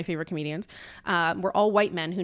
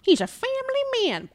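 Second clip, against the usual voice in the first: mock gushing praise. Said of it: "people would look at that and be like".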